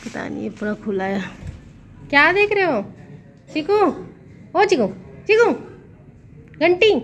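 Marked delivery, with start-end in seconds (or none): 2.1-6.9 high, loud, short calls; calling a pet. Real, not acted. Calling Chiku away from staring, high loud calls. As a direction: call brightly to a pet that ignores you.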